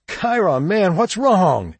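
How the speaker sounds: high, drawn-out desperate plea; imploring. A narrator's reading. Shug on his knees to Chiron, imploring. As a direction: beg an old acquaintance for mercy. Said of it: "he implored".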